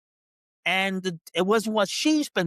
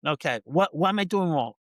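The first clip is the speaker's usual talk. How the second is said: calm reassuring; 4 semitones lower than usual. Retold or imitated. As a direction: meekly agree to fix it. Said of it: "So instead of saying".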